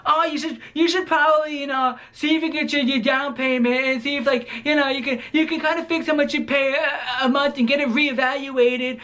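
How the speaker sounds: mocking advice-giving aide. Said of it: "this is the old people talk".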